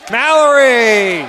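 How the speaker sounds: big crowd-lifting call of Mallory; excited call. Real, not acted. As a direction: stretch the name as he goes up. Call rising as the play scores.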